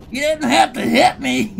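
high, loud wounded clown yelp. Pretend. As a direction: whine it like a hit clown. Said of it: "kept the clown voice the whole time".

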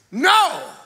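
sharp high shouted no; flat refusal to God; Peter refusing. Acted. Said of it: "Peter said".

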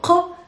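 q popped from the back of the throat; guttural qaf. Shown as a demonstration. Teacher isolating the ending q, guttural. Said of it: "guttural qaf at the ending".

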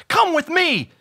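high, excited command; loud, eager storybook hero; urging. A narrator's reading. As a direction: call eagerly to follow. Excited Bob urges Todd.

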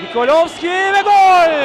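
roaring goal call, drawn out; screaming football commentator. Real, not acted. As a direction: scream the goal at the top of your lungs. Goal scored, commentator erupts.

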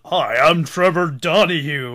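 a light anchorman impression; fake news voice. Telling his cohost about the villain, lightly voiced fake newsman. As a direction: mimic a newsman's smooth intro, lightly. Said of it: "fake news guy voice".

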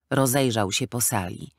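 usual speaking voice

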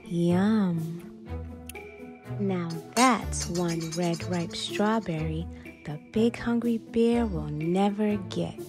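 ordinary delivery

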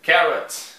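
Carrot said the English way, loud and clear; English pronunciation. Shown as a demonstration. Teacher modelling Carrot for students, slow and clear. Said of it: "olha só as traduções".

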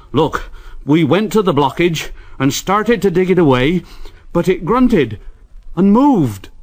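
breathless report of something in the tunnel; lightly voiced workman. A narrator's reading. Workmen telling the foreman, mildly alarmed.

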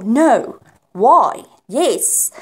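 loud, punchy exclamations; exclaimed loudly. Shown as a demonstration. Teacher demonstrating exclamations to the class, loud and punchy. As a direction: call out each word. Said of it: "some people say".

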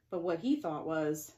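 usual speaking voice

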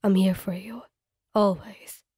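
low tender whisper; gentle whisper. Acted. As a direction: comfort her with a hushed promise. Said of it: "his voice gentle".

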